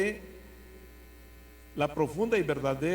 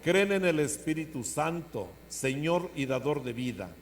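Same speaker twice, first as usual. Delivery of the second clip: liturgical proclamation; 2 semitones higher, 3 dB louder than usual. Acted. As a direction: proclaim each question solemnly. Priest leads creed questions.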